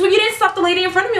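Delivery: high, fast mock of the customer; fast, high mimicking of the rude lady. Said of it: "She goes".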